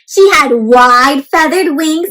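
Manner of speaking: slow, lilting read-aloud voice; story narration. A narrator's reading. Teacher reading a picture book to children, slow and lilting. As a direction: read it slowly to the little ones.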